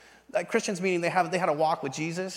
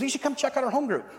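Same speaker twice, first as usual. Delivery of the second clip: higher-pitched mock friendly invite; lightly voiced upbeat inviter; friendly invitation; 8 semitones higher than usual. Church friend invites him to home group, higher friendly pitch.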